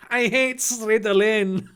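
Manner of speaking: mock Chinese accent, switching L and R in Slytherin; terrible Chinese accent. Draco insulting the Slytherin house, terrible Chinese accent. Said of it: "pronounce Slytherin with a terrible Chinese".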